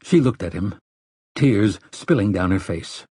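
ordinary delivery

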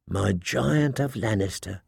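soft, low purr; seductive purr. A narrator's reading. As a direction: purr it teasingly, very softly. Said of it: "she purred".

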